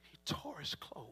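hushed, breathy whisper. Acted. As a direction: drop to a whisper for the grief. Hushed telling of grief.

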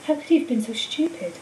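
ordinary delivery, nothing marked

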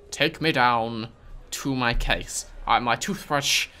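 slow, over-clear words to a machine; slow careful dictation. Real, not acted. Player dictating slowly to the baggage robot. Listing case contents to robot.

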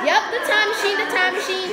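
excited time machine call; hyped repeat. Real, not acted. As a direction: announce it like big news.

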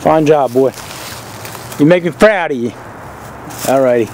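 ordinary delivery

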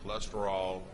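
ordinary talk, nothing marked

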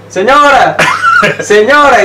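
high, hammed-up call to get her attention; repeated high-pitched appeal, acted out; retold calling out. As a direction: cry out in mock protest. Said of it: "Le empecé a gritar".

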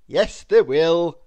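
a warm welcoming author; lightly voiced author. The author agreeing to hire Mister Small.

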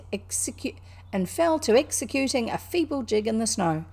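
ordinary delivery

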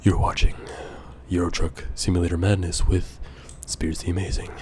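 low, hushed show intro; quiet, understated host introduction; regular Spears voice. Pretend. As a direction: drop into a soft near-whisper. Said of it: "let's go back to regular Spears voice".

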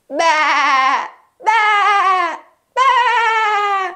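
wavering drawn-out baa; sheep bleat. Pretend. Playing the sheep for children, wavering bleats. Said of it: "It's a sheep".

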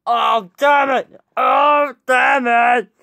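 high, drawn-out cry of hurt; pained wail. Acted. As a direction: wail it out slowly in pain. Acted pain after breaking hand.

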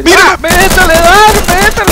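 high, loud cheering yell; excited shouting. Real, not acted. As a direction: shout them on, thrilled.

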